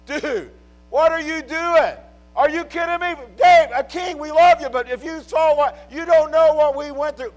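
loud, high imagined shout at the king; a mighty man shouting at his king in protest; mock protest shout. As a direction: yell it in disbelief at David. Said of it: "I'd be like".

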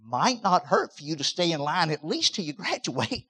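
ordinary delivery

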